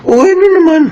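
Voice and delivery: sharp, high defensive snap back; defensive retort. Acted. Husband snapping back at wife's complaint, defensive.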